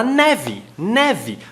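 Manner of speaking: "neve" ending in an I sound; "neve" pronounced nevi. Shown as a demonstration. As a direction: end "neve" with an I, not an E. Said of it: "você fala i".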